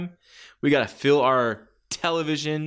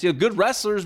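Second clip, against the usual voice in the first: usual speaking voice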